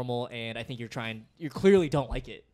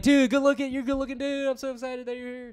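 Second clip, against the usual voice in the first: high, gushing hype; sustained high-pitched mock enthusiasm; really positive; 11 semitones higher, 5 dB louder than usual. Shown as a demonstration. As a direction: demo over-the-top delight at a guest. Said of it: "be really positive about it".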